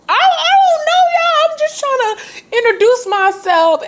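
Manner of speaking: very high, loud, panicky mock squeak; flustered squeak. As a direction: squeak out an embarrassed excuse. Retelling her awkward reply.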